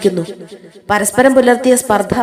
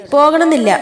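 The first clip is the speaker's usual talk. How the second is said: higher-pitched, mildly peeved retort; mild irritation; 6 semitones higher than usual. Acted. First labourer to his rival, mildly irritated. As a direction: put a touch of annoyance in it. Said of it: "ഈർഷ്യയോടെ".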